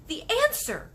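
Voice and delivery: excited cry. Acted. Girl announcing her idea to the giant, excited. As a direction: blurt the answer. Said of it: "cried Sophie".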